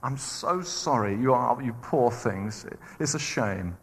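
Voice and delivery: slow, soft, mock-tender sympathy; mock pity. Preacher mocks God pitying the grumbling Israelites, slow mock-tender voice. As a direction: coo over them like a pitying nurse. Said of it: "God said".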